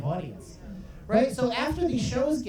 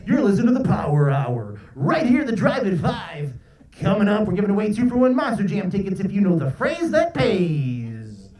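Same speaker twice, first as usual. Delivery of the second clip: loud, punchy rock-station DJ; radio DJ voice; 5 dB louder than usual. Acted. DJ plugging a ticket giveaway to listeners, booming radio voice. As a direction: hype it up like a rhyming radio DJ. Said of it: "the radio D Js".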